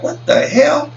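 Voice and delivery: high-pitched what the hell, shot up in disbelief; squeaky disbelieving what the hell; incredulous. Retold or imitated. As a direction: react like you can't believe your ears. Incredulous reaction to lisping talk.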